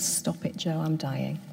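barely voiced, breathy and soft; a near-whisper from a dying wife; hushed plea. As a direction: hush him with what little breath is left. Dying woman tells husband to stop.

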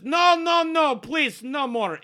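very high, loud female character voice; loud, high plea from behind the bar; barmaid pleading. Acted. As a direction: cry out to stop a brawl. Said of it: "The lady behind the bar says".

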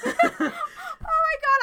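breathless, slow laugh through words; can barely talk for laughing. Real, not acted. Laughing hard at the impression.

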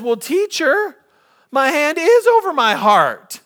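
put-on high, slow, earnest child voice; a small boy explaining patiently; little boy voice. [name] answers his teacher, high and slow like a child.

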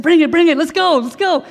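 loud, high and thrilled; eager excitement. Retold or imitated. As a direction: demand the soda right now, loud and high.

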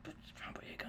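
excited hushed whisper, no voice. Retold or imitated. Telling a friend she spotted John Boyega, whispered. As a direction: whisper it so only your friend hears.

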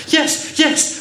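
put-on high, loud eager children. A narrator's reading. Children answering the girl about being good, eager. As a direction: pipe up eagerly, pitched high. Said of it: "they answered eagerly".

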